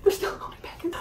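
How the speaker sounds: soft, voiceless repeat; hushed disbelief. Retold or imitated. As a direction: whisper it, stunned at the sight. Retold disbelief watching baggage being loaded.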